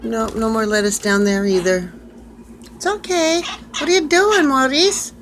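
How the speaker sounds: high, sweet voice cooing at a pet. Real, not acted. Owner calling to her parrot, high cooing baby talk. Coaxing bird inside house.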